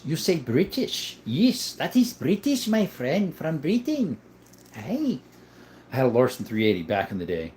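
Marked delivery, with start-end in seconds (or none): 0.0-5.2 slow, higher put-on British accent; mock British accent. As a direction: ham up a British accent, slowly. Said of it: "Was that an Irish accent?".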